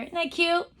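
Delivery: ordinary talk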